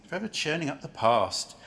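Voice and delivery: ordinary talk, nothing marked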